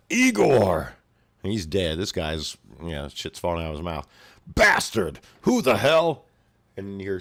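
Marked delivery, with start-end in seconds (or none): 0.1-0.9 a loud, high shout of the name. Retold or imitated. Thug yells a fallen friend's name. 4.5-6.3 loud, high furious yell; angry shout. Retold or imitated. Thug shouting in rage.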